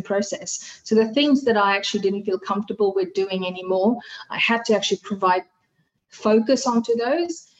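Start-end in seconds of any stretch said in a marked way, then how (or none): none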